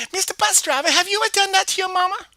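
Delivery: high, loud eager call; excited call. Acted. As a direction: grab his attention. Child excited to driver.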